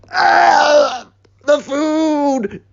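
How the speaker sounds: high, strained choking scream; choking cry. Acted. Cyril choking at the table, high strained cry. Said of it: "Cyril chokes in the background".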